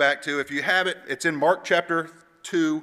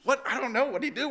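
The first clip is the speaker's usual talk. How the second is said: higher, hushed puzzlement; mock-bewildered, pitched up and softer; baffled friends; 7 semitones higher, 5 dB quieter than usual. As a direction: imitate confused kids asking among themselves. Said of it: "all of us are".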